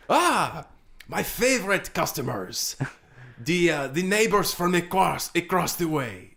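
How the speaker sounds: greeting regulars like a fussy French chef; mock French chef. Chef greets the neighbor detectives in mock French accent.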